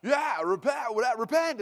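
a light mock yell of repent; mock church shout. Pastor parodying churchgoers, a light mock yell. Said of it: "They kinda".

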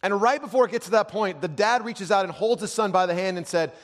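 high, loud, driving storytelling; full-force preaching. Real, not acted. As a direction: preach the story with mounting intensity.

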